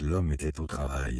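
ordinary delivery

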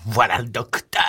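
usual speaking voice

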